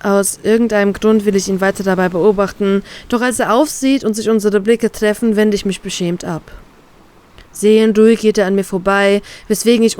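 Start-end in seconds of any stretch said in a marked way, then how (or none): none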